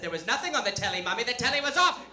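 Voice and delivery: hammed-up protest rising to a high shout; exasperated child. Child angrily contradicts mother.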